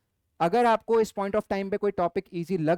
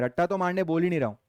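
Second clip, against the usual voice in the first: ordinary talk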